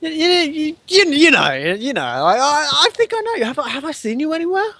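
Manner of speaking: high stammering stranger; stranger in recognition. Pretend. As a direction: stammer it high, piecing together a memory. GM voices stranger recognising player.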